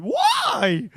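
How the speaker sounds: long, very high wail at full volume; mock anguished cry. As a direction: cry out like a hammed-up stabbing victim. Stabbed person crying out in anguish.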